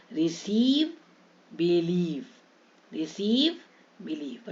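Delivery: "रिसीव" rising, "बिलीव" falling, both stretched; long ee. Shown as a demonstration. Teacher modelling ie and ei, long ee. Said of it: "उच्चारण बड़ी ई ही होगा".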